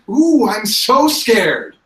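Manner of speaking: high, loud, drawn-out sarcasm; mock fear. Captive taunts the players' attempt to provoke, mock scared. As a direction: taunt slowly with exaggerated fake fright. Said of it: "he's just like".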